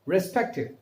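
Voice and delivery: normal talking voice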